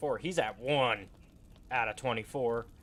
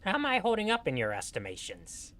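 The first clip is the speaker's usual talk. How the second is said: high gnome voice; Grupham's gnome voice; 6 semitones higher than usual. Acted. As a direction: squeak it like a little gnome. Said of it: "this is my gnome voice for Grupham".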